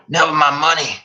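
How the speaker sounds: high, sour crone; scratchy old mother. Acted. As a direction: spit it like a sour hag. Mother voice scolding.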